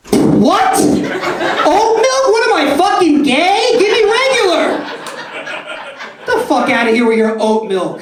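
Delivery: very high, loud, indignant screaming; hammed-up outrage. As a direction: explode in mock fury at the offer. Mock outburst at the barista.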